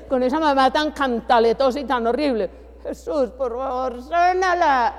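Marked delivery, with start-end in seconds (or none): 2.8-4.9 high, slow, begging little voice; children praying for a harsh mother, pleading; child's pleading prayer. Pretend. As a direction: plead like a small child asking Jesus.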